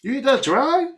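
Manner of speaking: high disbelieving question; can't believe what you heard; shocked you eat that dry; incredulous. Real, not acted. Disbelief at cereal choice.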